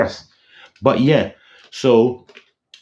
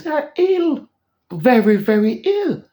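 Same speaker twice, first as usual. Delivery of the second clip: very high, drawn-out squeal of praise; high-pitched hype. Pretend. Fan praising Sony's cartoons, high drawn-out hype. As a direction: stretch it out in a high falsetto.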